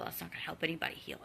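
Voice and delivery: very soft, quick near-whisper; hushed aside. Real, not acted. Repeating her point about healing, hushed aside. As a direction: drop into a fast, whispery aside.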